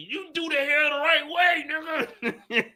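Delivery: high shouted scolding; incredulous shout. Real, not acted. Yelling at the witness through the screen, laughing.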